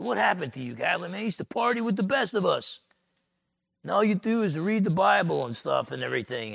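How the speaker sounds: a hint of a high, teasing jeer; lightly voiced mocking buddy; mocking taunt. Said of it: "starts making fun of Catlin".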